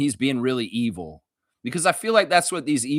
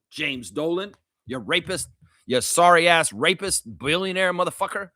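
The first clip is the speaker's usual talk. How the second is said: ordinary talk, nothing marked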